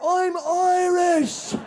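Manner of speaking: high, drawn-out roar for help; acted desperate long shout. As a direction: roar it across the water, stretching the words. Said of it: "And I had to roar".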